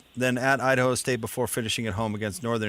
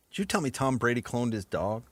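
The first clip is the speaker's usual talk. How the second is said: ordinary talk, nothing marked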